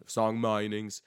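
loud, high, hammed-up Australian accent; loud mock Australian accent; terrible Australian accent. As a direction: ham up a bad Australian accent, drawn out. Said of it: "that's my terrible, uh, Australian accent".

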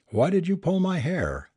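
high-pitched, contained storybook protest; mild shouted complaint. A narrator's reading. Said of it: "shouted Naren".

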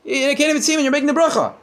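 high, fast mocking challenge; a sceptic jeering at the blind rabbi's blessing; scoffing Tzduki. Tzduki jeers at Rav Sheshes, high and fast.

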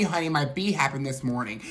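ordinary delivery

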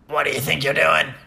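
raspy growl of an angry tree; deep, raspy tree. Acted. Tree scolding Dorothy for taking apples, deep raspy voice. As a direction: rasp it like a talking tree. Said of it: "a very deep and raspy voice".

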